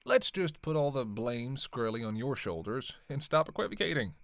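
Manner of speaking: normal talking voice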